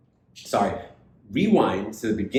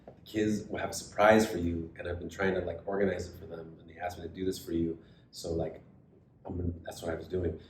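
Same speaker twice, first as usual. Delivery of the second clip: hushed retold lie; 3 semitones lower, 8 dB quieter than usual. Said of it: "to Nikki and I say".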